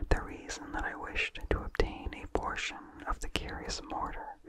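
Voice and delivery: usual speaking voice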